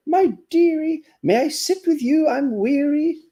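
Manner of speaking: a weary turtle pleading tenderly; storybook turtle wooing a bagpipe; lovesick turtle. Acted. Turtle speaks in the poem.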